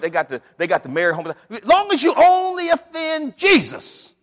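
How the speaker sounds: loud, rising mock rant about complacent Christians; Brother Fox's rant. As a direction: belt out a fed-up old church brother's complaint. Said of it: "Brother Fox would always say".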